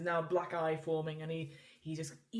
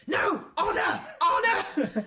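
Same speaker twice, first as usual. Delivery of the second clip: much higher, loud outraged shouts; indignant refusal; 11 semitones higher, 7 dB louder, much slower than usual. Acted. Quin Quin refusing the bribe, high outraged shout. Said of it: "He goes".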